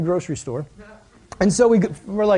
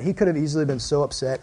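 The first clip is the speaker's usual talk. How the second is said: ordinary talk, nothing marked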